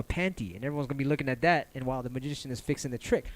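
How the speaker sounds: ordinary talk, nothing marked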